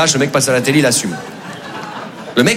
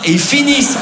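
ordinary talk, nothing marked

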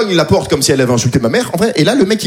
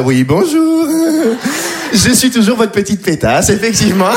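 higher little polite phone voice; lightly raised courteous tone; 3 semitones higher than usual. Retold or imitated. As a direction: put on the sweet bank-customer voice. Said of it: "cette petite voix un peu plus aiguë".

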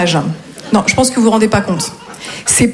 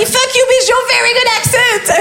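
much higher, slow shout in broken English; mock beggar shouting; 12 semitones higher, slower than usual. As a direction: yell it back rudely. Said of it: "il m'a dit".

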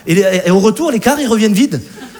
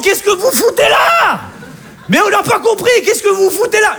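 mock outrage; 9 semitones higher than usual. Comedian yelling at fans who came anyway, exasperated. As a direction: shout it up high in comic disbelief.

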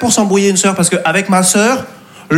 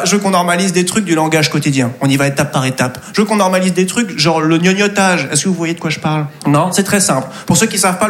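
ordinary talk

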